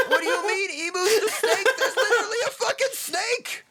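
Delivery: very high incredulous shout, laugh breaking in; incredulous yelling. Real, not acted. Co-host firing back in the snake argument, yelling high. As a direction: shout it back in disbelief, voice shooting up. Heated mock-angry argument over anime.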